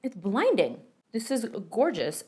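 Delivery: normal talking voice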